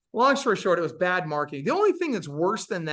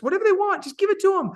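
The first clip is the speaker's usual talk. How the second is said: emphatic coaching; 6 dB louder, faster than usual. Real, not acted. Coach stressing service.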